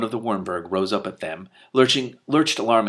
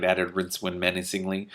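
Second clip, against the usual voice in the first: usual speaking voice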